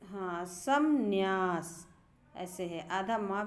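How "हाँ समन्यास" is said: समन्यास slow, with an audible half म; drawn-out समन्यास, म then न्या; careful pronunciation. Shown as a demonstration. Teacher demonstrates real pronunciation to class, slowly. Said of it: "वास्तव में इसका उच्चारण ऐसे है".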